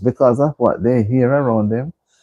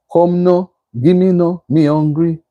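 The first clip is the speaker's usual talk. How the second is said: broad Jamaican patois; 5 semitones higher than usual. Shown as a demonstration. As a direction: lay on thick Jamaican patois for fun. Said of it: "them kind of broad".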